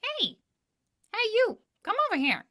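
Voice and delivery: put-on be the tiny food on the plate calling the kid over; talking food voice. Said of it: "I'll often personify the food".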